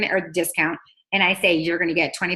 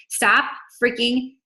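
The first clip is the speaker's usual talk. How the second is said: slow, punched, a little higher; emphatic retold scolding; 5 semitones higher, much slower than usual. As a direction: lean hard on each word. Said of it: "'cause I was like".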